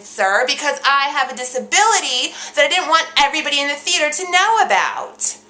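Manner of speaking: loud, high-pitched public announcement; stagey projection. Acted. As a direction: project the secret to the whole theater. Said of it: "why are you speaking like that".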